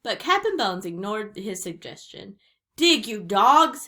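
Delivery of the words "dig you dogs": loud, slow, drawn-out pirate command; shouted order. A narrator's reading. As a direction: shout it at the crew, stretching the words. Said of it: "he shouted".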